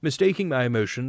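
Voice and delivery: plain reading voice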